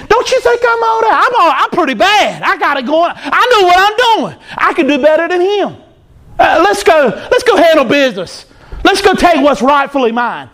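cocky high-pitched strutting voice; boastful Lucifer. Retold or imitated. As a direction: preen to the angels like the proudest being in heaven.

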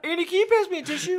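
high, hammed-up tearful request; hammy fake-crying plea; mock weepy plea. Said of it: "You're faking so bad".

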